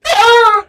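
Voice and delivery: sudden high pained yelp; old man cry. Acted. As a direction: cry out as you're blasted back. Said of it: "Beckett's old man body goes".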